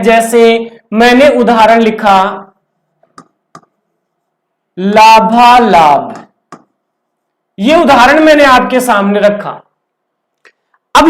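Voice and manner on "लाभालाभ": लाभालाभ stretched out very slowly, syllable by syllable; slow dictation. Shown as a demonstration. Teacher to class, giving the example word, very slow dictation. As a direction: draw out लाभालाभ as if chalking it letter by letter.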